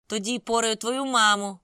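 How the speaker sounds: loud, slow snarling taunt; growled threat. A narrator's reading. Andrew snarling at Lin, loud and slow. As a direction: growl the insult to provoke a fight. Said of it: "прогарчав він".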